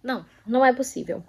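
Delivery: soft, exasperated disbelief; can't believe what she reads; a hint of muttered dismay; quiet disbelief. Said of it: "Eu assim".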